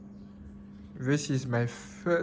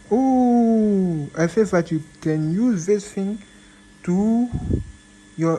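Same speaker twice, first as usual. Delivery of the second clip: excited; 4 semitones higher, 6 dB louder than usual. Real, not acted. Excited over the trackpad.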